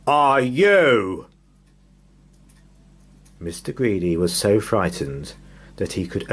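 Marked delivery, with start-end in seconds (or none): 0.1-1.3 loud booming giant; thundering giant. Acted. Giant holding Mister Greedy booms a loud question. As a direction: boom it out like a huge giant. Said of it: "thundered the giant".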